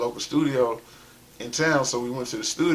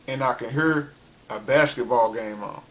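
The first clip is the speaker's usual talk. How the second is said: ordinary delivery, nothing marked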